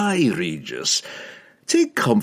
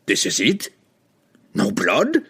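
rising indignant questions; disappointed outcry. A narrator's reading. Pook robbed of his bloody show, indignant disbelief. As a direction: ask in affronted disbelief. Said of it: "Pook cried".

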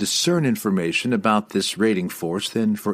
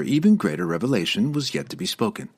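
ordinary delivery

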